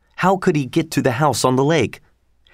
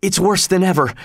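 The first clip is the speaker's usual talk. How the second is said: ordinary delivery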